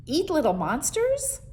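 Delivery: can't believe what he just heard; incredulous boy. Acted. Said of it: "Howard tumbled back and laughed".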